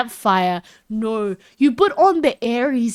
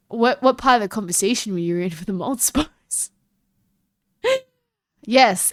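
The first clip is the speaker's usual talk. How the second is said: ordinary talk, nothing marked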